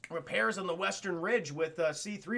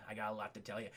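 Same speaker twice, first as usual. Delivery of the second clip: much lower and much softer, almost breathed; hushed mentor; 11 semitones lower, 13 dB quieter, much faster than usual. Retold or imitated. Old Ben promising secrets, much lower and softer. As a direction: fade it to a secret hush.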